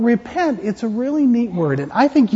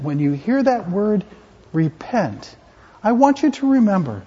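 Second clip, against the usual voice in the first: ordinary delivery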